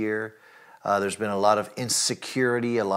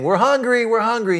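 much higher, louder mock child whine; whiny kids; 11 semitones higher, 6 dB louder than usual. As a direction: play the hungry kids nagging. Kids saying at breakfast time.

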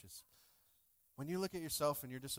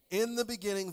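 "In the beginning" proclaimed louder and higher; emphatic call; 7 semitones higher, 7 dB louder than usual. Shown as a demonstration. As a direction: lift "In the beginning" like a preacher's call. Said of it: "somebody say".

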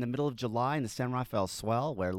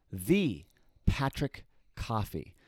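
grand announcer intro; 5 semitones lower, 3 dB quieter, slower than usual. Pretend.

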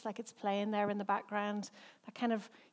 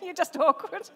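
laughing; 7 semitones higher, 9 dB louder than usual. Real, not acted. Laughing while repeating her words.

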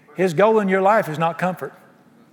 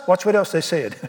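ordinary talk

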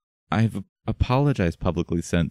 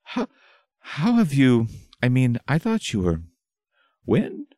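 stunned stammering; 10 semitones higher than usual. Acted. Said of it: "jaw hanging slack".

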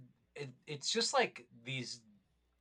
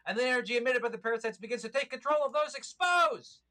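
building to a loud dramatic peak; old sci-fi announcer; 4 semitones higher, 4 dB louder than usual. Pretend. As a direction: grow louder like a movie trailer. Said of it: "a fun voice".

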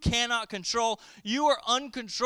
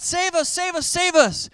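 high, louder cry of save us; mock crowd yell; 9 semitones higher, 6 dB louder than usual. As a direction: call out as a crowd would. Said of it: "they're yelling out".

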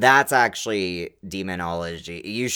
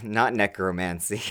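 usual speaking voice

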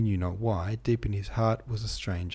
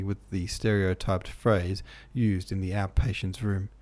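usual speaking voice

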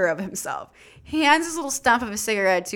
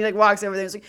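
plain reading voice

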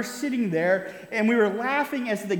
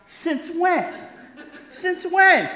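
put-on higher confused child's yell, building louder; 9 semitones higher, 4 dB louder, much slower than usual. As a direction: yell it back at big brother in disbelief. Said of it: "in confusion, yelled back".

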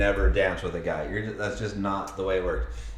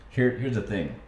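normal talking voice

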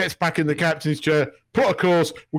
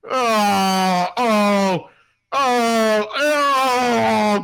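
rising, louder pained oh's; pained groan; 7 semitones higher, 5 dB louder, much slower than usual. Retold or imitated. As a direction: groan as if it still hurts. Recalling the ear slug scene.

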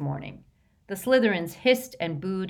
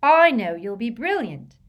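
high, loud encouraging call; bright raised-pitch cheer; 5 semitones higher, 8 dB louder than usual. A narrator's reading. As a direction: gush encouragement across the table. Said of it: "called Lavender".

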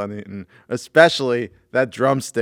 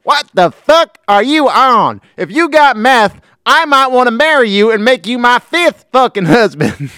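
high, loud, brash cussing; Joe Exotic; 14 semitones higher, 11 dB louder than usual. Acted. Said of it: "So this is Joe Exotic".

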